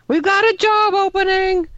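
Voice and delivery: waiter's holler. Retold or imitated. Waiter mocks a colleague's dropped tray, hollering. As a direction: holler it high, like an announcement to the room. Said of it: "another waiter just hollered".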